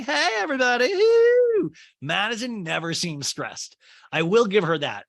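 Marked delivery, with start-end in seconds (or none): 0.0-1.7 squealed high and loud, carefree and bubbly; carefree cheer. Retold or imitated. Madison breezing into the party with a high squealing woo. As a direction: burst in with a shrieking happy woo. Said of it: "like, not a care in the world".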